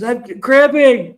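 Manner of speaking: drawn-out, high, loud mock scream; retold scream. Man on the landing answers his friend, screaming in imitation. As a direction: cry out slowly and high, imitating agony. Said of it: "But he was screaming".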